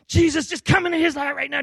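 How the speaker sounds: high, loud, impassioned plea; fervent prayer. Retold or imitated. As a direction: imitate a friend praying at full fervor. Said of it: "like, all fervent prayer".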